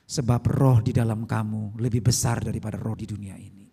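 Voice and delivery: low, loud solemn pronouncement; inner voice. Retold or imitated. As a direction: voice a weighty word heard in the heart. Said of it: "dalam hati ada suara". (suara hati yang berat dan khidmat)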